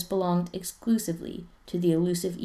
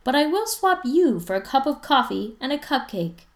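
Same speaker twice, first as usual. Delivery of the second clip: calling across; 8 semitones higher, 7 dB louder than usual. Acted. Mom still calling across hall.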